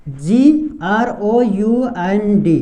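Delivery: slow, letter-by-letter spelling; spelled out. Shown as a demonstration. Teacher spells ground for beginners, slow spelling. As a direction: spell it out slowly, each letter on its own. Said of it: "उसी तरह ground लिखेंगे".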